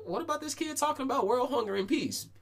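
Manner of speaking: mock indignant protest. Complaining that the ostrich speech beat serious speeches, mock high protest. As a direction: squeak it out like a wronged kid. Retelling own indignant thought at state finals.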